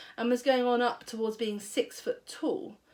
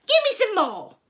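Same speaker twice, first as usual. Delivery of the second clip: much higher, louder screechy demand; greedy old grandma; 11 semitones higher, 8 dB louder than usual. A narrator's reading. Grandma demanding more medicine.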